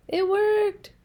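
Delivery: high, bright surprise that it worked; can't believe his luck; delighted. Acted. Elephant cheers that asking the birds worked, high and delighted.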